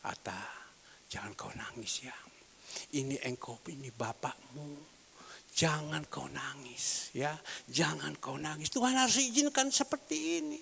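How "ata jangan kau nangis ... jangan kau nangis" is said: soft, low, slow whispered comfort; tender hush. Retold or imitated. Father soothing his crying daughter Atalia, hushed. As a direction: hush a crying child gently.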